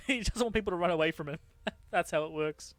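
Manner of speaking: ordinary talk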